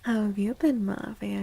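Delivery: usual speaking voice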